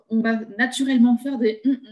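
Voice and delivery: ordinary talk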